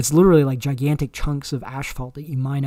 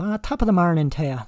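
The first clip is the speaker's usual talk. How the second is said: light mock Irish brogue, a little higher; 4 semitones higher than usual. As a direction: toss off a quick cheesy Irish greeting in a hint of Irish accent. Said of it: "the sort of stereotypical".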